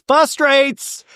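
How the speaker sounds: high, loud "frustrates" as "fustrates"; mock the slip, crying out "frustrates" without the first r; mispronounced frustrates. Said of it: "you can't say the word frustrate".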